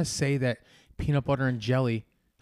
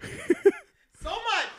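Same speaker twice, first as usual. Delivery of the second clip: much higher, soft, laughing through the words; 5 dB quieter, slower than usual. Real, not acted. Host laughing helplessly at cohost's joke. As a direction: squeak it out mid-laugh.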